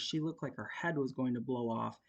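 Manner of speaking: ordinary delivery, nothing marked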